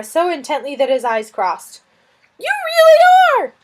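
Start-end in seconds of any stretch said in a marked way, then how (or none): 2.4-3.5 high, loud lizard delight; a flustered lizard thrilled at the news. Acted. Lizard Suz marvelling at the fire witch, high and loud.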